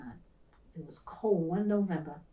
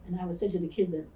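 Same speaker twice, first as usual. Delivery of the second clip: ordinary talk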